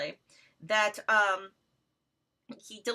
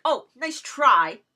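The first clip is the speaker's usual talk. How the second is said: louder, slow sneer; imitating Jeff's dismissal; mock dismissive; 2 semitones higher, 10 dB louder, much slower than usual. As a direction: brush it off with scorn. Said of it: "So he's just like".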